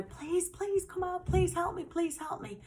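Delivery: high, urgent begging for help, reenacted; desperate plea. Girl banging on a bathroom window for help, high desperate pleading. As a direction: act out frantic pleading, pitched far up. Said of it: "I'm banging on the bathroom window".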